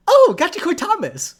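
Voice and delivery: high, loud mock "Oh!" with a falling end; imitating her inflection. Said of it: "the inflection in her voice".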